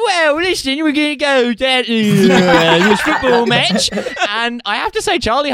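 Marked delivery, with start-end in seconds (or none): 0.1-3.9 mock posh actor straining at a Cockney accent, pitched higher; a posh man failing at Cockney, laughing through it; bad Cockney accent. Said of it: "has to do a Cockney accent".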